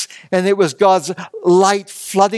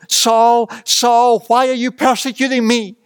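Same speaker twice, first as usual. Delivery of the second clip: a raised, higher calling voice from heaven; voice of the Lord; 4 semitones higher than usual. Acted. The Lord calls to Saul on the road, raised and urgent. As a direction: call out his name with urgency. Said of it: "he heard the voice of the Lord speak to him".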